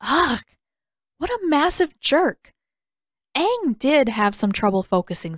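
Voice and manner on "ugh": ordinary delivery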